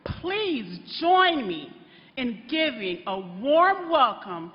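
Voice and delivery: a loud rally introduction that climbs to a shout on the name; rally announcer. Acted. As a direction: announce her like the headliner, rising to a roar. Introduces the candidate.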